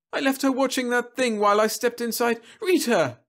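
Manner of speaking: high, distressed woman's call; a mother searching in panic; frantic mother. Acted.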